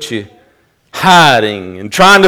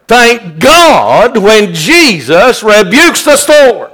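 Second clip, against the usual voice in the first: high, loud and slow praise; preaching climax; 10 semitones higher, 8 dB louder, much slower than usual. Acted. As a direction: lean into each word.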